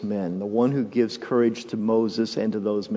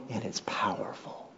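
whisper; 4 semitones lower, 13 dB quieter than usual. Real, not acted. Repeated line, whispered first.

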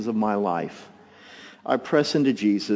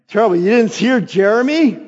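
higher, louder put-on wife voice; wife's voice; 9 semitones higher, 8 dB louder than usual. Husband retells wife's morning question, high put-on voice. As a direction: play the tired wife asking, loud and high. Wife asks about crying baby.

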